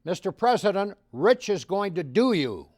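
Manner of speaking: loud call across the pool party; yelling out. Retold or imitated. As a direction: call out to the President over the crowd. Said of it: "yelled out".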